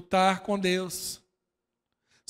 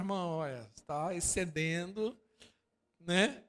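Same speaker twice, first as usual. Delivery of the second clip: calm rebuke; 6 dB quieter than usual. Retold or imitated. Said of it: "Você fala".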